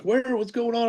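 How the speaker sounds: startled retold question. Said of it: "I'm like".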